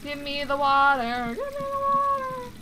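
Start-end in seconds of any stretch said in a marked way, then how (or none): 1.3-2.5 high, squeaky put-on voice; mock squeaky demand. Shown as a demonstration. Player mocking the character's demand, high squeaky voice. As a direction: repeat it as a whiny little character. Repeats line two ways.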